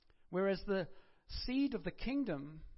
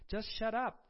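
sudden louder, higher snap; blunt shut up; 4 semitones higher, 5 dB louder than usual. Acted. Preacher telling the congregation to hush, a blunt snap. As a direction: cut them off bluntly. Preacher blurts rude command.

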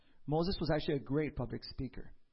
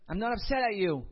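higher, louder upset denial; 5 semitones higher, 5 dB louder than usual. Retold or imitated. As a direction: protest too much, clearly irritated. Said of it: "you sound like you're upset".

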